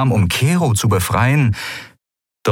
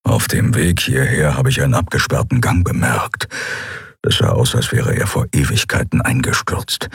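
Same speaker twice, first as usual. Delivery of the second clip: lower, murmured companion voice; calm knowing murmur from the next cell; low hushed Keru; 5 semitones lower than usual. Acted. Keru's voice continues.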